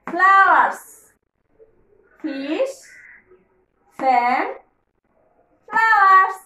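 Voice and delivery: loud call-and-response word drill; drill for repeating. Shown as a demonstration. Teacher drilling kids to repeat, loud chant. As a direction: chant the words for kids to echo. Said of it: "আমার সাথে সাথে কিন্তু বলো".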